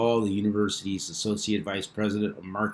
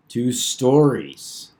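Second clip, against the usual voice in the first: "stories" slow, drawn out and punched; stress on stories; 3 semitones higher, 6 dB louder, much slower than usual. Real, not acted. YouTuber doubting the news calls it a story, stressing "stories". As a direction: lean hard on "stories", slowly. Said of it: "and I emphasize the word story".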